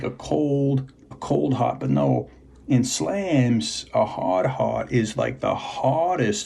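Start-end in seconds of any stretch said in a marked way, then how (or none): none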